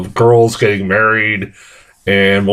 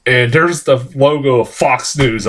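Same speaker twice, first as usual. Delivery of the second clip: usual speaking voice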